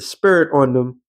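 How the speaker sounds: normal talking voice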